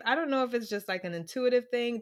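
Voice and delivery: ordinary talk, nothing marked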